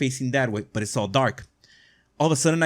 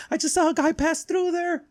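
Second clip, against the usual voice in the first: mock startled girl; 3 dB quieter than usual. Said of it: "screams again".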